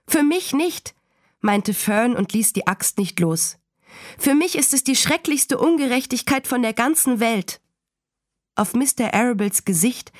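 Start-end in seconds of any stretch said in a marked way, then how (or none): 0.1-0.9 put-on high-pitched girl's firm objection; defiant child. A narrator's reading. Daughter refuses to give in. 4.2-7.6 put-on high-pitched girl's protest; indignant child. A narrator's reading. Daughter protests the injustice.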